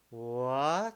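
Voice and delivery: long questioning rise on "What?"; rising second tone. Shown as a demonstration. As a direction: stretch "What?" into an upward glide. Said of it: "it's from the middle".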